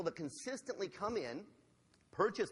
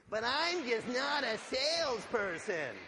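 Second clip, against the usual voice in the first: high, slow, louder nasal whine; group chant of self-pitying whining; nasally whiny voice; 7 semitones higher, 5 dB louder, slower than usual. Acted. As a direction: lead the crowd in an exaggerated whine. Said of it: "repeat with me in your most nasally, whiny voice".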